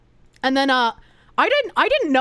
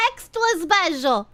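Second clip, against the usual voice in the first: mocking gasp; 7 semitones higher than usual. Mocking chat's special names.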